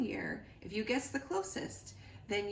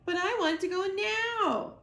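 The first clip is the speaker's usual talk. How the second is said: high, loud demanding whine; whining gosling; 11 semitones higher, 6 dB louder than usual. Acted. Gosling defies his robot mother, high and loud. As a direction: demand it like a furious child.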